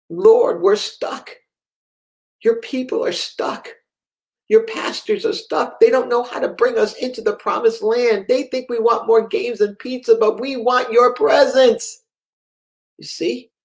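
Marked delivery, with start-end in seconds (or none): 0.1-12.0 higher, impassioned plea imitating a boy; retold pleading prayer. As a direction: cry out to God on the child's behalf. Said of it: "And then he says".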